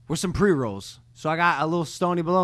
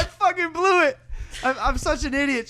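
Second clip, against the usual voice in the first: high, squeaky mock despair; self-mocking panic; 10 semitones higher than usual. A guest mocking his own panic after greeting Chelsea. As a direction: whine it like a guy who just blew it.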